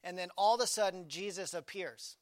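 normal talking voice

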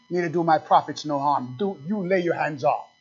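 ordinary talk, nothing marked

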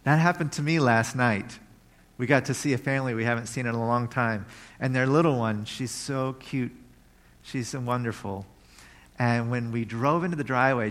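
normal talking voice

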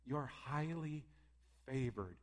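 soft and slow, hushed; tender. Acted. God speaking softly to the listener's spirit. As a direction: lay each word down gently. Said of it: "he's speaking to us and saying".

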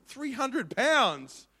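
slow, high, loud disbelieving echo; astonished. Retold or imitated. Preacher retelling his shock at the price, slow, high and loud. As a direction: stretch it out in disbelief. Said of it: "It's like".

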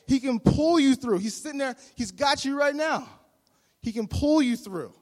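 high, loud, rousing exhortation; full-force preaching. Acted. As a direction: drive the encouragement home fervently.

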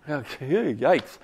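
ordinary talk

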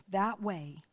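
normal talking voice

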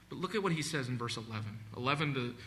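normal talking voice

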